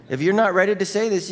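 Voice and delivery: usual speaking voice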